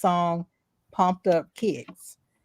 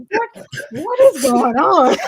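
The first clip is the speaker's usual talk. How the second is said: higher, louder, laughing through the words; baffled, slow, cracking up; laughing disbelief; 8 semitones higher, 7 dB louder, slower than usual. Retold or imitated.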